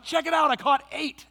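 put-on high, loud kid voice, proud of her catch; excited little girl. Daughter proudly showing dad her lizards, high and gleeful. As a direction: crow it like a thrilled child.

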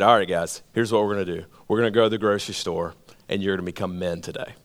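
low, gruff uncle laying down the law; deep man's man voice. Retold or imitated. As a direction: drop low and sound like the big tough uncle. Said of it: "And he said it like that".

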